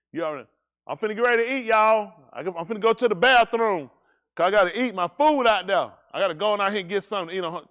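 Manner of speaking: loud and a bit fast, calling out to the room; hammed-up loud announcing. Preacher telling the congregation he is off to eat, loud. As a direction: belt it out like announcing to everyone.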